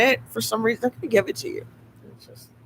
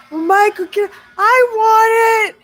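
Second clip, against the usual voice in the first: whiny laughing plea; 7 dB louder, slower than usual. Retold or imitated. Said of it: "I'm all".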